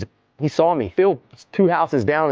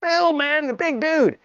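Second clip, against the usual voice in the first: amazed telling. Real, not acted. Marvelling that big Phil ran.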